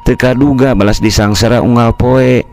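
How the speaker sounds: plain reading voice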